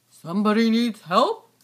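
low rumbling monster asking; deep monster voice. Pretend. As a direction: boom it out like a big beast. Deep put-on voice.

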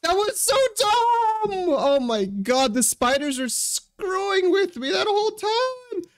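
slow high drawn-out scream; frustrated screaming. Real, not acted. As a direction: wail in disbelief. Loses the run.

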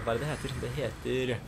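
ordinary talk, nothing marked

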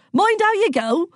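high, hearty country woman's call; merry, high-pitched farm-woman voice; jolly countrywoman. A narrator's reading. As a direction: sing out a cheery warning. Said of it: "laughed a ruddy-faced woman in overalls".

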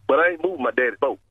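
usual speaking voice

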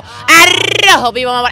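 high, slow, stretched-out shout; catchphrase shout. Pretend. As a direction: belt out the catchphrase like a show host. (grita su lema, agudo y lento)

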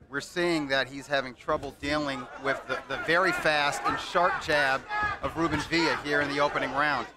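ordinary talk

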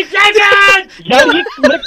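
high, slow exasperated shout. Real, not acted. Pranked man demands to know what is going on, exasperated. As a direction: cry out in real bewildered frustration.

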